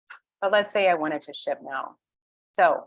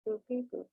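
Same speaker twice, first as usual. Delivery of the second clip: soft, higher playful boop-beep sounds; beep noises; 5 semitones higher, 9 dB quieter than usual. Retold or imitated. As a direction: mouth little computer beeps, quietly. Mouthing computer noises.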